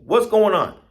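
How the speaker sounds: high, loud challenge; demanding question. Acted. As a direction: demand an explanation from the monkeys. People demand an explanation from monkeys.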